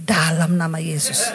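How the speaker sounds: low, laughing mock rebuke; half-laughing rebuke, pitched low; rebuking in Jesus' name. Preacher to tempting voice, a low, laughing rebuke. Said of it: "saya langsung tengking".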